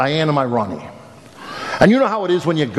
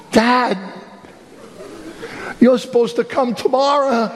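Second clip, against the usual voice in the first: put-on much higher, startled teen voice; sleepy startled son; 10 semitones higher than usual. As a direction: play the caught-out son.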